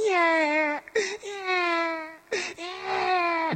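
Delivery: put-on high, drawn-out baby wail; slow, piercingly high mock infant bawl; imitated newborn cry. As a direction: mimic a newborn's first cries. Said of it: "Docteur fait le cri".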